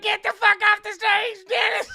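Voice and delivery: screamed high-pitched heckle; full-volume mock crowd yell; jeering crowd. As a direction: shout him down like a rowdy crowd.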